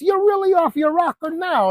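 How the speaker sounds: very high mock outrage; incredulous owner. New owner rejecting the buy-back offer, mock incredulous. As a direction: send the voice way up in mock disbelief. Acted quarrel over buying back.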